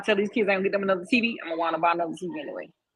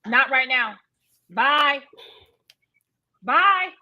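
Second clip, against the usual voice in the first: louder and higher with each bye; 6 semitones higher, 7 dB louder, much slower than usual. Real, not acted. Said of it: "Why are you yelling at your kids".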